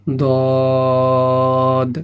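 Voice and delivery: দদ sung out full and heavy; heavy letter demo. Shown as a demonstration. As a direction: give দদ a thick, chanted sound. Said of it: "এখানে মোটা চিহ্ন আছে". (দদ মোটা করে সুর টেনে)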